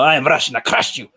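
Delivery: Russian accent. Shown as a demonstration. Showing friends the trick, Russian accent. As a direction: put on a stock Russian accent. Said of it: "when you go Russian".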